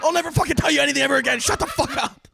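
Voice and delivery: high-pitched, indignant retold outburst; freaking out. Friend freaking out at being exposed, high and frantic. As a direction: imitate a frantic friend snapping back. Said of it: "he's freaking out at me".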